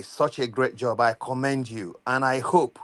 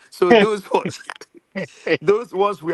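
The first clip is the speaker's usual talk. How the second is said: laughing; 6 semitones higher, 6 dB louder than usual. Real, not acted. Joking about Precious's uncles.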